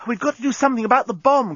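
high, fast urgent cry. A narrator's reading. Nettie pressing the captain about the bomb, crying out. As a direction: rush out an alarmed appeal. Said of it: "cried Nettie".

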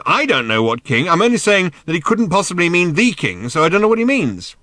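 loud exasperated explanation; high-pitched, argumentative shout. A narrator's reading. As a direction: yell it, rising in pitch. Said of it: "shouted Ford".

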